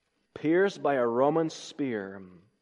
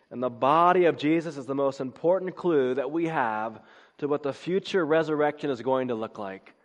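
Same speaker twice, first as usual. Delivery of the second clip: normal talking voice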